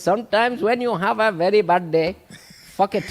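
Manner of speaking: high, loud Indian accent impression; doing a viral Indian motivational voice. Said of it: "that Indian voice where he says".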